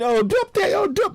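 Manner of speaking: sarcastic high-pitched imitation; mocking. Mocking careless contributors.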